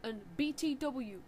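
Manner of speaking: usual speaking voice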